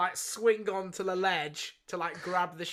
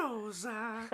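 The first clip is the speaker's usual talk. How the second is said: softer put-on cartoon Rosa; Family Guy character; 3 semitones higher, 9 dB quieter than usual. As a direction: echo the cartoon impression quietly. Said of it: "from Fireman Sam to Family Guy".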